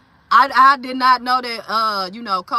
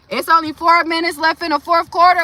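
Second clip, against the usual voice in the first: excited; 5 semitones higher, 6 dB louder than usual. Real, not acted. Watching the game, checking score.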